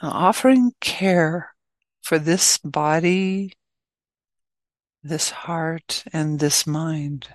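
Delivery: unhurried, spacious delivery, phrase by phrase; guided meditation. Real, not acted. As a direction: ease listeners into stillness, leaving space between phrases.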